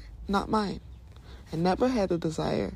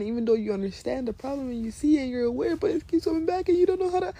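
rising, faster, exasperated; 7 semitones higher, faster than usual. Real, not acted. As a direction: speed up and climb in frustration. Frustration building fast.

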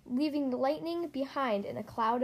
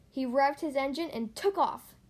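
ordinary delivery

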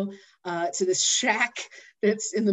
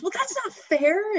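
put-on little boy; 13 semitones higher than usual. Boy to grandpa, high sulky complaint. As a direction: whine it like a kid. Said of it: "And he says".

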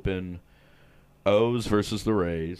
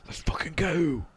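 hushed, voiceless let's go; whispered cheer; 5 semitones higher than usual. Acted. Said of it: "What an embarrassing accent".

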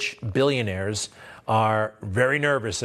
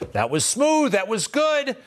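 much higher, grudging admiration; impressed; 11 semitones higher than usual. Real, not acted. Host grudgingly praising rival's answer, impressed and high. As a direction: admit it was slick, lifting up.

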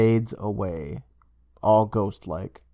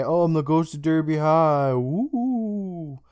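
raised pitch, drawn-out spooky "woo"; haunt them like a prank-call ghost; mock ghost voice; 4 semitones higher, slower than usual. Bullies on the phone pretending to be a ghost, mock spooky wail. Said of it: "pretending to be a ghost".

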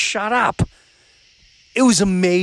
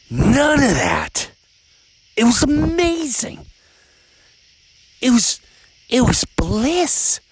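mock-dreamy gush, pitched much higher; gushing bliss; 8 semitones higher, slower than usual. Parent recalling vacation without kids, swooning gush. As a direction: swoon over the kid-free getaway.

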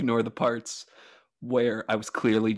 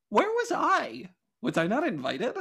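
mock indignant; 11 semitones higher than usual. Mock outrage at missing a wedding.

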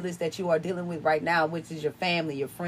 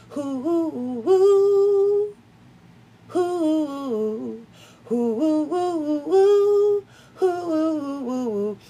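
melodic wind howl; 6 semitones higher, much slower than usual. Retold or imitated. Podcaster showing listeners the wind's melody, high swelling musical hoots. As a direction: imitate wind singing long, gliding "hoo" notes. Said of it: "hold a melody like this".

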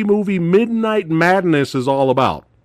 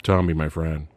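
low, soft, unmoved line reading; deadpan bad acting; 11 semitones lower, 6 dB quieter than usual. Host mocks bazooka guy seeing friend die, deadpan bad acting. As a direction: imitate an actor showing no grief. Repeats the actor's underplayed line.

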